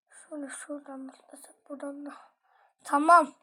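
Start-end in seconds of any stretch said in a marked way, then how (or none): 0.1-2.1 whisper. Real, not acted. Planning a jump.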